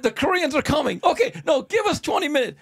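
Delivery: shouting at the leaving champ to hold on; frantic pleading. Retold or imitated.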